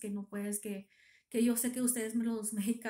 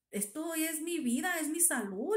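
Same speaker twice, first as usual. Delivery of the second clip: retold tearful plea, pitched higher; queja llorosa; 7 semitones higher than usual. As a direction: plead for your health, voice lifting. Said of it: "le dije, ya llorando". (súplica dolida, imitando el llanto)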